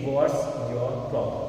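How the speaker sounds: very slow and careful; slow demo. Shown as a demonstration. Teacher modelling a question very slowly. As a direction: drag each word out for learners. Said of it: "तो हम उनसे पूछेंगे".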